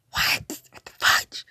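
punchy, breath-heavy outburst of disbelief; mock-appalled exclamation. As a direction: blurt it out, baffled. Repeats the reaction more forcefully.

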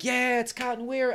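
high-pitched fan cheer; mock excitement. Viewer spotting Cotton in the opening, excited. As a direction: greet a favorite character's return, high.

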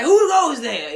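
high, loud mock-startled challenge; mock guard challenge. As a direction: call out like a spooked sentry. Startled by own reflection.

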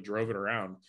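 ordinary talk

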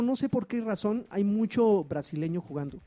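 ordinary talk

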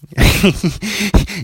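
giggling high; high burst of laughter. Real, not acted. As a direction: laugh at your own joke. Laughing at Philly hell joke.

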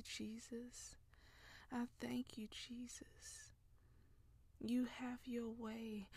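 hushed, tender prayer, half whispered; intimate prayer. Real, not acted. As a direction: pray softly as if to a lover, barely voiced. Intimate whispered love prayer poem.

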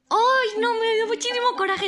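loud, high-pitched exasperated wail; exasperated outburst. Retold or imitated. Student recalling her rage at the profe, exasperated outburst. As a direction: burst out high, reliving the mortified anger. Relives her mortified anger.

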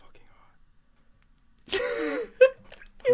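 slow, high whispered gasp from the heat; real pain from hot sauce. Real, not acted. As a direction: barely get it out through the burn. Burning hot sauce in mouth.

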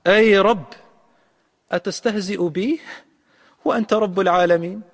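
high, loud disbelieving cry to his Lord; incredulous plea. Retold or imitated. The last man in paradise asks his Lord, incredulous. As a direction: ask it as if you can't believe you're being teased. Man's incredulous reply to God.

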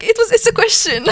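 mock-exasperated high-pitched insistence; exasperated protest. Retelling a misunderstanding with a classmate, exasperated high protest.

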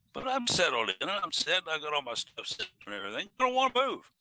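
put-on loud, high grumpy old man complaint; cranky old man. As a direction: whine like a cranky old man refusing to budge. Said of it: "cranky old man yelling".

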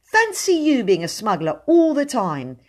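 put-on indignant young captor; righteous indignation. A narrator's reading. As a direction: accuse with offended virtue. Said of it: "with righteous indignation in his voice".

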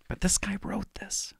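breathy hushed whisper of disbelief. Real, not acted. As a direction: whisper it, stunned he wrote it. Disbelief at the written line.

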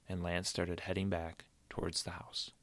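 unvoiced hushed narration; whisper. A narrator's reading. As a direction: breathe the words out.

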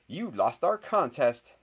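high, loud crowing; triumphant shrieking. A narrator's reading. Girl tells rival he lost their contest, shrieking. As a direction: crow loudly over the win. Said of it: "shrieked Hermione".

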